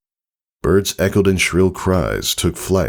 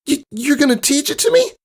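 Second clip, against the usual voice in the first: very high, stammering amazed filly; can't believe her luck; stunned delight. Acted. Scootaloo gasping at Tycho's offer, stunned and thrilled.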